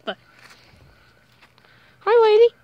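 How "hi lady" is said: high, loud hello to a pet; excited dog greeting. Real, not acted. Vlogger greets the dog Lady, high and excited.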